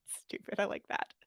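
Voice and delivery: hushed, breathy aside to herself; whispered aside. Real, not acted. Reader breaking off to whisper an amused aside. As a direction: whisper it under your breath.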